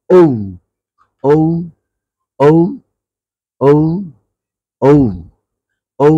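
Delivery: "ঔ" gliding from oh to oo; letter O pronunciation. Shown as a demonstration. Teacher drilling learners on O, "ঔ" as oh-oo. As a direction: drill "ঔ" singly as a rounded diphthong. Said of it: "আমরা ওর উচ্চারণ করবো".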